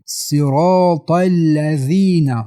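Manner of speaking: thick s, r and t in slow صراط الذین; heavy emphatic letters. Shown as a demonstration. Teacher showing heavy sad in صراط, slow and thick. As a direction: chant صراط with full, dark consonants and long vowels. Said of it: "صاد حرفای غوص است".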